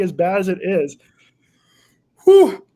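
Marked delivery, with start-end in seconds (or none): 2.2-2.6 loud pained whew. Real, not acted. Blowing out from the burn.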